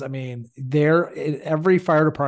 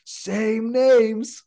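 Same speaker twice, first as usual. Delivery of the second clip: mock yell, higher and drawn out; imitated yell; 8 semitones higher, 4 dB louder than usual. Coworker yelling on hearing a call's address, imitated yell. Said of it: "he would yell".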